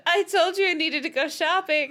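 loud, high forced cheerful tone; breezy cover-up, overdoing it; forced lightness. Acted. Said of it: "as lightly as I could".

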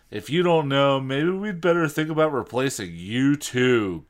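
lightly hammed-up snarl at the bandmate; mock snarl. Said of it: "Guy snarled".

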